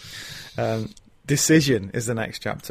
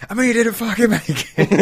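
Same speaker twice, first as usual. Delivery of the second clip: retold angry shout; 11 semitones higher, 5 dB louder, faster than usual. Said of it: "the way he shouts at".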